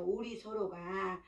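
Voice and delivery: "우리 서로가" zacht uitgesproken, laag gehouden; zacht en ingetogen. Shown as a demonstration. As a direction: eerste keer 여리게, zacht. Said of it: "두 번째 나오잖아요".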